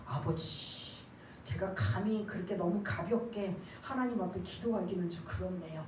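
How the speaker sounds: overly reserved adult prayer; timid formal prayer. Shown as a demonstration. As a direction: pray stiffly and too politely. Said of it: "이렇게 하지 말라는 거예요".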